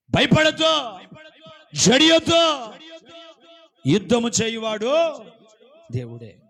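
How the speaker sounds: loud, high sermon exhortation; preaching at full force. Acted. Preacher exhorting the congregation, loud and high. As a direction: cry it out to the congregation.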